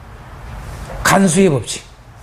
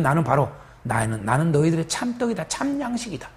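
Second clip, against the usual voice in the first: hushed Jesus quote; 5 semitones lower, 10 dB quieter than usual. Retold or imitated.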